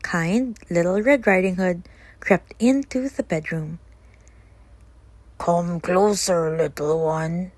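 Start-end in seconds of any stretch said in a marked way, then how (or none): 5.4-7.5 slow, lightly disguised wolf; squeaky wolf. Acted. Wolf in disguise luring the girl, slow. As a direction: coax her closer sweetly. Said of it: "squeaked the wolf".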